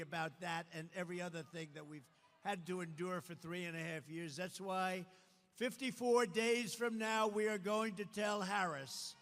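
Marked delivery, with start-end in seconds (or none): 5.6-9.1 slow, louder and higher roar; rally shout. Real, not acted. Rallying voters against Harris, slow booming shout. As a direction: build to a booming crowd call. Rally climax, roaring at crowd.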